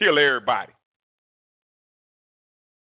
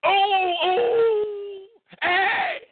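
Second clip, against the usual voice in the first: high crying hollers of a crowd; mock crowd hollering, much higher; imitating a hollering crowd; much slower than usual. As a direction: whoop like a mob at a fight. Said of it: "Everybody holler".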